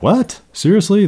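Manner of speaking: high, sharp disbelieving "What? Seriously?"; incredulous. Shown as a demonstration. Teacher showing the class an incredulous reaction, high disbelief. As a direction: gasp out your disbelief. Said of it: "That look you have when you're like".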